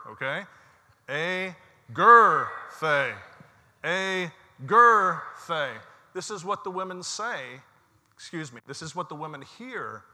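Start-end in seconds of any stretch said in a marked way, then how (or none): 1.1-5.7 much louder shout of "A Gerthe" with weight on gerthe; loud congregational call. Real, not acted. Congregation shouting the angels' word at the preacher's cue, loud. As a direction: shout it together, hitting "Gerthe" hardest. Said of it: "you guys have to be the loudest".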